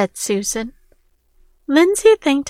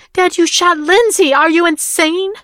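much higher, louder outraged cry; horrified shout; 7 semitones higher, 6 dB louder than usual. Acted. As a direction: shout at your father in disbelief. Son confronts father after shooting.

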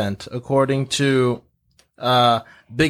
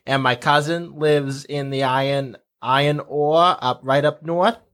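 Minnesotan accent. Shown as a demonstration. Local chatting about family and cheese curds, Minnesotan accent. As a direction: put on a folksy Minnesotan accent. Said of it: "they can all go back to speaking like".

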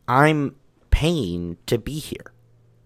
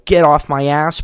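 loud, higher-pitched retort; re-enacted yell; 5 semitones higher, 7 dB louder than usual. Climber snapping at the gym guy before leaving, retold yell. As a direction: fire back at him, raised voice. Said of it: "I yelled that".

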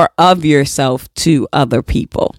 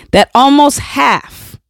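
emphatic shout; 7 semitones higher than usual. Shown as a demonstration. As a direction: punch almost half out. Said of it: "stressing the statistic".

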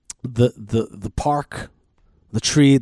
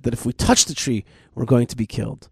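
ordinary delivery

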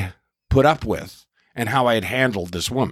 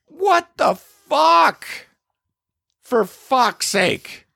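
much higher, slow disbelieving shout; exasperated outburst; 13 semitones higher, much slower than usual. Real, not acted. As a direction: drag it out in outraged disbelief. Exasperated at the absurd story.

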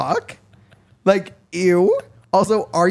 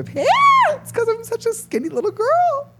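put-on squeaky girly voice; slower than usual. Jokey put-on voice.

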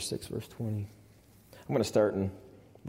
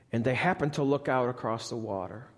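normal talking voice

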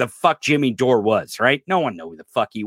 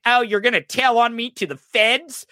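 mock Trump; 10 semitones higher than usual. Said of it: "Trump's like".

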